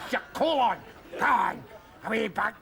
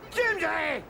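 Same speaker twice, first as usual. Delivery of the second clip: high call, falling at the end; 7 semitones higher than usual. Acted. Drunk man calling out again, high, for Jamesy. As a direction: call his name into the distance.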